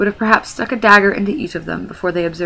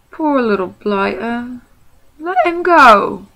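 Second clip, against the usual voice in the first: slow, rising to a loud high plea; soften, then call to let him go; pitying troll; 5 semitones higher, 6 dB louder, slower than usual. Acted. William pities the hobbit.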